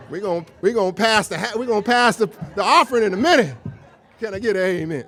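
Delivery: loud, laughing church preacher voice; mock preacher. Speaker jokingly preaching to the audience, loud and laughing. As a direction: ham it up like a preacher calling for the offering. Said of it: "feeling like I'm in church".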